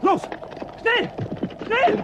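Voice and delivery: sharp, high urgent cry; shouted urgent order. Acted. Urgent command in danger.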